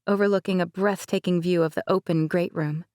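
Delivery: usual speaking voice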